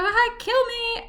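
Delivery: very high, slow retold squeak; mortified squeak. Embarrassed customer leaving, high mortified squeak. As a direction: squeeze it out high and drawn out.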